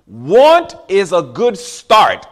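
very slow, weighted words; slow preaching emphasis. Real, not acted. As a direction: lay each word down slowly. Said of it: "Don't lose that".

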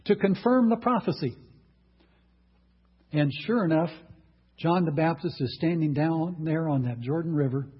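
normal talking voice

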